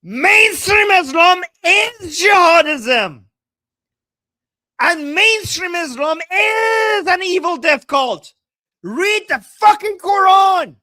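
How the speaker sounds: high, drawn-out full-voiced shout; angry shout. Real, not acted. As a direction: shout it out slowly, outraged. Angry rant answering clip.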